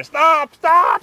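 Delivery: sharp high yell, retold; yelling stop. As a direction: shout it like a warning. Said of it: "I yelled at her".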